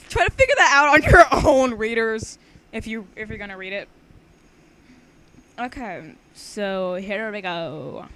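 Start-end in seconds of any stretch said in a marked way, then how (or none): 0.1-1.8 1 laughing. Real, not acted. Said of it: "laughing at readers".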